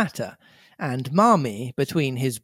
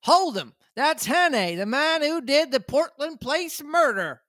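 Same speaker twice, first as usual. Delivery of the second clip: shouting; 11 semitones higher than usual. A narrator's reading. Shouted accusation in the crowd.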